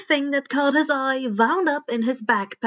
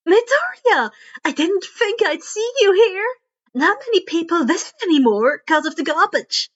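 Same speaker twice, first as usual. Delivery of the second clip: much higher and louder, bubbly greeting; thrilled to see a friend here; excited girl; 6 semitones higher, 5 dB louder than usual. Acted. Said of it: "eyes lighting up with excitement".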